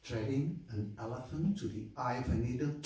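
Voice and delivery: dry, incredulous question; skeptical Brahmin. Acted. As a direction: echo it back in scornful disbelief. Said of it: "the Brahmin goes like".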